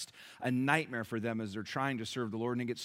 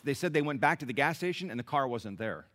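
ordinary delivery

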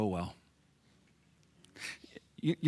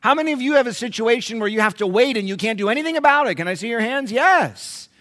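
high, loud preaching to the church; preaching at full force; 12 semitones higher, 10 dB louder than usual. Acted. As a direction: drive it home to the congregation. Preacher addressing congregation.

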